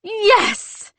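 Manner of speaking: sudden high shout of triumph; triumphant yes, high and loud; triumphant cry. Acted. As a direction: yell it out, found it.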